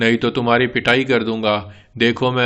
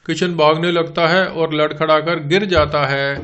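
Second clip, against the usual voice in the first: normal talking voice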